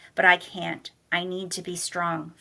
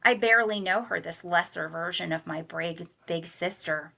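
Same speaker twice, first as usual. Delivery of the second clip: ordinary delivery, nothing marked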